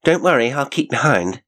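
high-pitched, miffed retort; incensed. A narrator's reading. Janet to the rude man, incensed. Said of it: "incensed by his rudeness".

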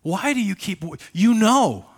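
high, loud exasperated complaint. Acted. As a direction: throw up your hands in mock frustration. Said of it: "That's a legitimate question".

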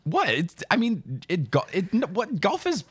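high, stammering disbelief; sputter, unable to finish the thought; flustered disbelief. Real, not acted. Golf defender answering a disgusted co-host, flustered disbelief.

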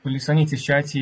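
ordinary delivery

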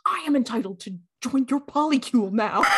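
a light put-on voice claiming his place; lightly voiced smug Kylo, half laughing; mock entitled Ben Solo. Podcaster mocks Ben Solo demanding to join, lightly voiced smug claim.

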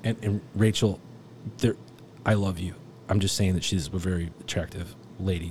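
normal talking voice

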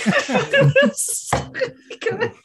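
high, slow words through laughter; laughing. Real, not acted. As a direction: stretch it out while cracking up. Player cracking up mid-praise.

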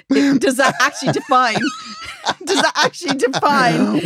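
high-pitched, laughing through the question; real laughing fit. Real, not acted. Host quizzing her co-host on the confession, laughing hard. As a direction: ask it while helplessly cracking up. Cracking up over a colleague's confession.

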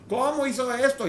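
high, loud indignant cry; indignant outburst. Retold or imitated. Person reacts in outrage to a wrong, loud indignant cry.

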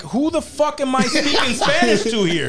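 high, laughing exasperated comeback; a laughing mock protest to his girl; laughing retort. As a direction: toss it back through laughter.

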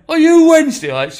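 loud, high-pitched challenge; imitated accusation. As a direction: call out rival fans in mock outrage. Voices suspicious rival fans.

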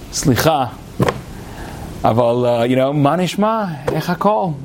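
put-on Hebrew spoken with a light American accent; friendly Hebrew with an American accent, played down. Greeting a man collecting money, Hebrew in an American accent. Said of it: "said it with, like, an American accent".

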